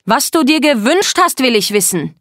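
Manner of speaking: high, loud insistence; angry demand. Acted. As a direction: demand it so everyone hears. Wütende Forderung vor allen. (wütend und laut fordernd)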